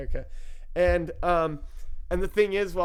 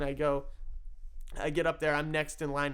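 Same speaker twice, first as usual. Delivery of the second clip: ordinary talk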